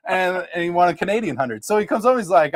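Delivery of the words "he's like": ordinary talk, nothing marked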